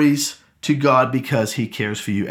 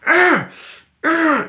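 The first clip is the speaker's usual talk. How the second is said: put-on much higher kid's effort groans; frustrated child grunts; 12 semitones higher than usual. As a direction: grunt like a boy fighting a stuck lid. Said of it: "I can hear him struggling".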